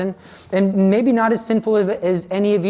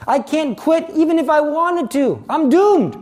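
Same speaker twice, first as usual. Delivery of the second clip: high, loud lament, crying out in despair; mock-crying wail of doom; retold tearful despair; 10 semitones higher, 3 dB louder than usual. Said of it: "I started to cry".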